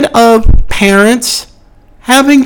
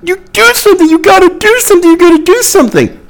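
high, frantic mock outcry of "do something"; a hammed-up imitation of protesters yelling "You gotta do something"; mock scream; 10 semitones higher, much faster than usual. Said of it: "You can scream".

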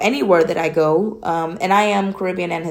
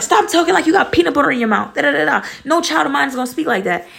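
high-pitched imitation of a scolding mother; raised-pitch mock maternal telling-off; scolding mom; 8 semitones higher, faster than usual. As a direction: act out mom telling off her child. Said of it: "she'd be like".

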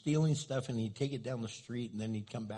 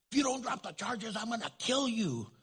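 imitated threat, pitched much higher; mock threat; 11 semitones higher than usual. Inmate threatening the pastor on the phone, mock menace.

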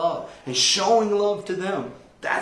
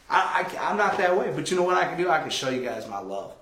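ordinary delivery

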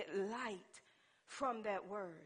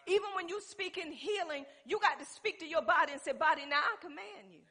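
loud, high-pitched pulpit delivery; full-force preaching; 5 semitones higher, 12 dB louder than usual. Real, not acted. Preacher telling people their lifestyle must match belief, forceful preaching.

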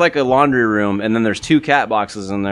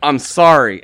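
louder, higher mock apology; retold apology; 4 semitones higher, 6 dB louder, slower than usual. Man saying sorry to the cat, mock pleading. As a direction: imitate insisting on being sorry, voice raised. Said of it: "and he goes".

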